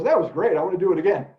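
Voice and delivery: a teacher voicing Tigger's delight at tripping over a rock, lightly excited. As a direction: cheer like Tigger who loves falling over. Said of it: "He's just Mister Excitement".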